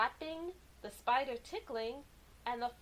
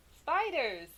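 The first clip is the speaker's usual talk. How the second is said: excited exclaim; 4 semitones higher, 6 dB louder than usual. Acted. Triumphant answer to own riddle.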